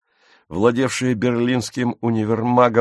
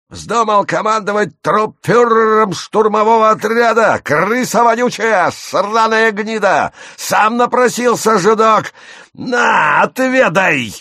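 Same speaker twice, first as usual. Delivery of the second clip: high, very loud ranting yell; furious high-pitched demagogue shouting; frenzied Nazi-leader rant; 11 semitones higher, 7 dB louder than usual. Acted. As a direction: scream it like a dictator haranguing a crowd. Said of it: "завопил истово, подражая нацистскому вождю".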